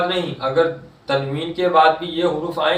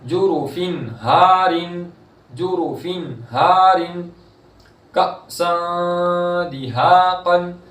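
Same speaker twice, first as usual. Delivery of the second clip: slow, drawn-out Quranic chanting; tajweed recitation; 3 semitones higher, much slower than usual. Shown as a demonstration. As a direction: stretch the vowels in recitation. Recites example.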